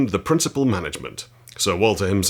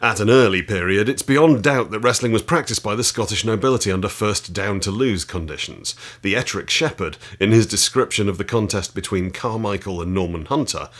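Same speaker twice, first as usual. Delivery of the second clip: a firm, louder read-aloud voice; 4 dB louder than usual. Acted. Reading chapter six of the wrestling book to viewers, with relish. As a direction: read the old text out with relish.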